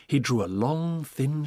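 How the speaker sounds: ordinary delivery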